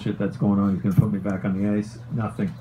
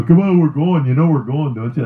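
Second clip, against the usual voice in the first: mock challenge; 5 semitones higher, 4 dB louder than usual. Fighter's challenge before the fight.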